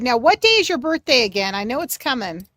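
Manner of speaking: ordinary talk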